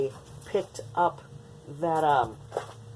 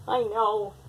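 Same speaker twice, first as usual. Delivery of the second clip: higher cooing I know; 7 semitones higher, 3 dB quieter than usual. Real, not acted. Baby talk to cat.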